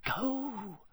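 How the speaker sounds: hushed, high-pitched urgent plea; soft, high, desperate urging; silent pleading. A narrator's reading. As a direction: plead softly, as if only thinking it. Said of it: "Harry pleaded with him silently".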